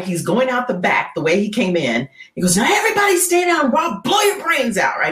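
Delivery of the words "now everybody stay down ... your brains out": mock robber shouting at everybody. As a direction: yell it on the way out. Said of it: "cursing and swearing".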